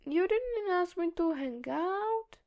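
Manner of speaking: mock pout. A narrator's reading. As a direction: fake disappointment, playfully sulky. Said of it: "Tim jokes with a pout".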